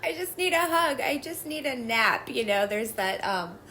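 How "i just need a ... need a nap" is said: put-on higher, pleading little-kid voice; mock teary child wanting a hug; whiny little child. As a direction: whimper it like a tired toddler. Inner child's wish.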